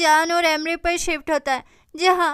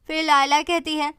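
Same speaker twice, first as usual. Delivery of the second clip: ordinary delivery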